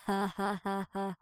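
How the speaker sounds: usual speaking voice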